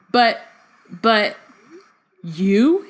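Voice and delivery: ordinary talk, nothing marked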